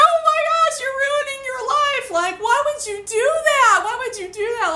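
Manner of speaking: very high, distraught wail of reproach; imitated bawling. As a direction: imitate a sister wailing in shock. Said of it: "She started bawling".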